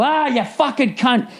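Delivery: drawn-out, high outburst of abuse; mock furious yell. Caller cursing the phone robot on mute, yelling. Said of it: "if you yell".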